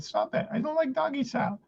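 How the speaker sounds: a light, prim imitation of the girlfriend objecting; a hint of a prissy girlfriend; girlfriend's voice.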